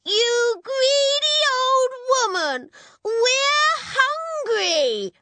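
put-on high, loud outraged child; angry shouting. A narrator's reading. Hungry girl to the greedy witch, angry shouting. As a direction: shout it out, stretching the words. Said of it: "Kate shouted at her some more".

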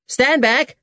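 sharp high shout of command; captain shouting at the confession box; shouted order. A narrator's reading. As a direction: bark it out to clear the way. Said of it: "he shouted".

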